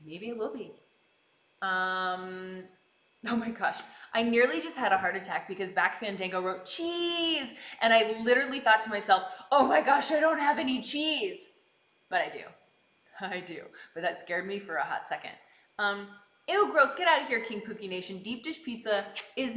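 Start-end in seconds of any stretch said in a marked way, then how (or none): none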